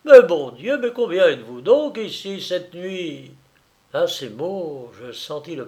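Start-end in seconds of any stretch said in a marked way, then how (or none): 0.0-3.3 loud exasperated outburst, hard attack at the start; bizarre exclamation. A narrator's reading. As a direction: burst out with a startled question. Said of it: "s'exclama-t-il d'un ton bizarre". (exclamation forte et bizarre)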